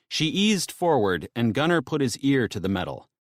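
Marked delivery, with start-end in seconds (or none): none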